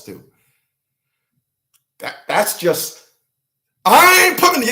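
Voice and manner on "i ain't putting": high, loud mock protest; hammed-up indignant excuse; boy trying to protest. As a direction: blurt a high-pitched denial as the boy. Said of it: "Dude was trying to talk".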